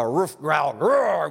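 growly dog noise rising to a roar; husky dog growl. Pretend. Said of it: "in your Finnish dog husky voice".

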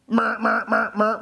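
imitated alarm beeps.

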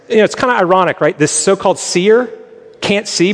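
usual speaking voice

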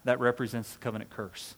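ordinary talk

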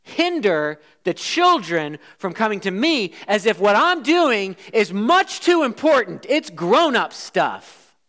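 paraphrasing Jesus with mild indignation; indignant rebuke. Acted. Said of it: "What Jesus is saying here is".

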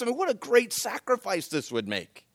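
usual speaking voice

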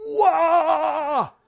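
loud, high laughing shout; inner whoop of joy. Retold or imitated. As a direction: burst out with delighted excitement. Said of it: "je réagissais comme ça".

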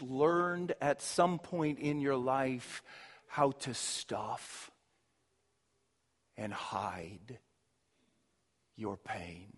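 sinks from a low murmur almost to nothing, slowing down; fading hush. Acted. Preacher to congregation on stuffing pain, fading to a hush. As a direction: let the words die away as you speak of hidden pain.

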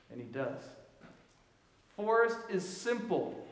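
ordinary delivery

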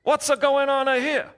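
high, hammed-up Italian accent. Italian stranger walking over to the roadside stop, high Italian accent. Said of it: "in an Italian accent said".

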